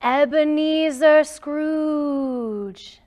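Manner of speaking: slow, drawn-out ghostly call; ghostly cry. Acted. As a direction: stretch the name out like a spirit from far away. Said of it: "a voice cried out".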